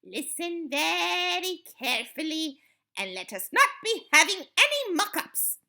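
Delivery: high, loud stern warning; snapped. A narrator's reading. Grand High Witch instructing her witches, snapped. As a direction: warn them sharply. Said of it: "snapped the Grand High Witch".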